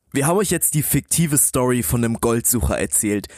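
usual speaking voice

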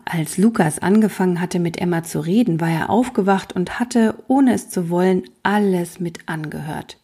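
ordinary delivery, nothing marked

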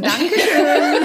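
laughing thanks. Real, not acted. Pleased after slow title.

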